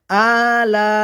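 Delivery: "আলা" chanted with both vowels stretched; measured elongation. Shown as a demonstration. Teacher showing learners "আলা" with drawn-out vowels. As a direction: hold "আ" one beat, stretch "লা" longer. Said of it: "আ এক আলিফ পরিমাণ টান হবে".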